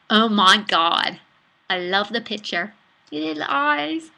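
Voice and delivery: cooing fondly. Real, not acted. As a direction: coo over a sweet photo. Gushing over a cute picture.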